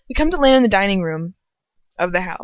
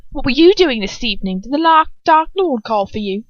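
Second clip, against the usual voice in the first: loud, higher demanding question; 6 semitones higher, 5 dB louder than usual. Acted. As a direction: ask it loudly, pressing for an answer. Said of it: "Bellatrix asked loudly".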